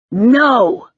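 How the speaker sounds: loud, sharp refusal; shout. Acted. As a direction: yell it at him.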